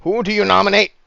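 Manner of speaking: high, loud call for order; yelling over the crowd. Acted. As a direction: shout to be heard over noise. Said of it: "he's trying to yell".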